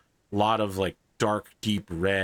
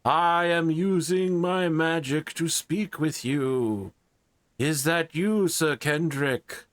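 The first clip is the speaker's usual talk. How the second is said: king voice; 7 semitones higher than usual. Acted. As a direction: speak like a stately king summoning a knight. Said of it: "the King of Somerset".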